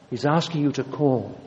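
usual speaking voice

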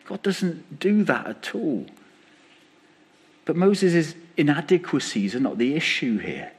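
ordinary talk

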